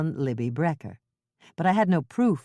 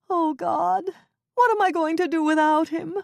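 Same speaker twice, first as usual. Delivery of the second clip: sobbing wail; 12 semitones higher than usual. Acted. Wife crying over her arrested husband, high and anguished. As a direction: wail it into the table. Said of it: "burst out sobbing".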